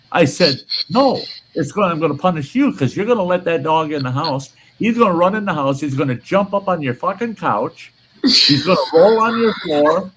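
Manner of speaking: retold rant. Ranting at the neighbour about the dog, heated. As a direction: lecture him, building outrage.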